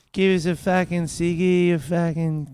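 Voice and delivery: high, broad Australian bogan; bogan chick accent. Acted. Demonstrating hot bogan women, high Australian accent. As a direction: cadge like a bogan Australian woman. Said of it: "Bogan chicks have the hottest accent".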